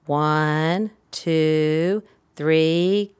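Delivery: sustained ONE, TWO, THREE, rising at the end; counting in cadence. Shown as a demonstration. Therapist counting before blowing bubbles, sing-song cadence. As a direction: draw out each number with a pause, lifting THREE. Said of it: "the same cadence".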